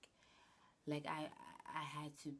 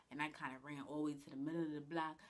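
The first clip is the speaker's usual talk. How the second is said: ordinary talk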